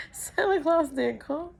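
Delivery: put-on very high child's wail; about to cry. Child waking brother about missing Santa, tearfully squeaky. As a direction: mimic a kid on the verge of tears. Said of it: "I'm about to cry".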